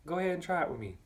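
high, teasing dare to the bullies; taunting dare. Retold or imitated. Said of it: "So I let 'em know".

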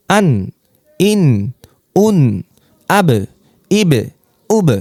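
each of "আন", "ইন", "উন", "আব", "ইব", "উব" cut off short at its final consonant; short separate syllables, voice pressed shut on the last sound; closed syllable drill. Shown as a demonstration. Teacher drilling students on closed sounds, short and pressed shut. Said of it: "আওয়াজকে চাপিয়ে দিতে হবে".

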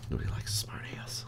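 hushed whispered scolding; whispering. Real, not acted. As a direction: whisper it as a side remark. Scolding the character aside.

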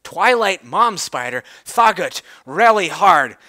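ordinary delivery, nothing marked

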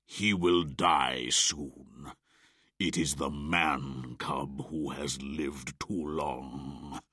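lightly voiced tiger, slow and scornful; sneering tiger. Shere Khan demanding the man cub, slow contempt. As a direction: drag out every word like a lazy predator. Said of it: "Shere Khan spoke".

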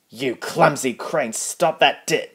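angry shout. Acted. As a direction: bellow at the clumsy crane. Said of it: "shouted Spencer as loudly as he could".

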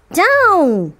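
witch shouts "Down!" to land the broom; witch's cry. Acted. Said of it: "cried the witch".

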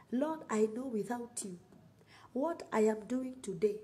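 ordinary talk, nothing marked

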